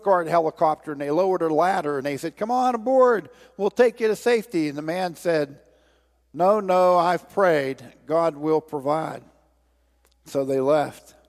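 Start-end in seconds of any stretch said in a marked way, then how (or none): 2.4-4.7 calling down. Acted. Story, helicopter crew calls down. 6.3-9.2 put-on stubborn old man. Story, old man refuses helicopter.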